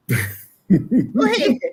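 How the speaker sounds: slow words through a real laugh. Real, not acted. Laughing after friend laughs.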